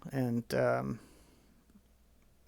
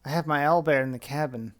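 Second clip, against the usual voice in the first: calling out; 9 dB louder than usual. Acted. NPC captain calls from ship.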